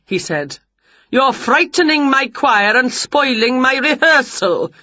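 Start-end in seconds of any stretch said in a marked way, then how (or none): 1.1-4.7 mildly cross, pitched higher; scolding conductor. A narrator's reading. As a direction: complain as a put-out conductor.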